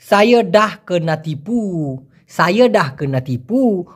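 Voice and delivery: very slow, clear Malay; slow Malay short form. Shown as a demonstration. Teacher giving Malay short form, very slow. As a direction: say the short form slowly, word by word. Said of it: "মালয়েশিয়ানরা এই কথাটি শর্টকাটে বলে".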